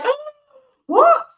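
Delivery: very high, loud mock-shocked "what"; retold astonishment. Friend hears about the handsome pilot, astonished. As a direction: gasp the oh, then shoot right up on what.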